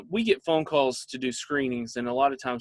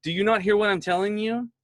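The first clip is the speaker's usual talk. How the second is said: put-on higher-pitched, exasperated kid; retold exasperation, voice pitched up; imitated frustration; 7 semitones higher, 3 dB louder than usual. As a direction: mimic a frustrated child's insistent question. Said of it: "he's getting frustrated 'cause he's like".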